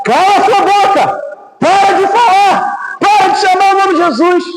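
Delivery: high-pitched mock scolding from a crowd; acted angry shout. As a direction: act out shouting someone down. Crowd acted silencing the blind man.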